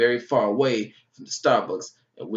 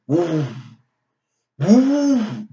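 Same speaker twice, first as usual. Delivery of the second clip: a rising whooshing woo, the second far higher; wind howl imitation; 6 semitones higher, 7 dB louder than usual. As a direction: mimic the wind picking up.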